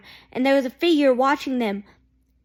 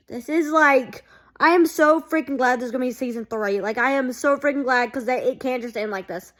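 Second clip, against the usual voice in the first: normal talking voice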